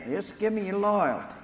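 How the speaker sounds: a hint of a smug politician asking for loyalty; governor demanding loyalty. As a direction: lean on it like a boss calling in a favor.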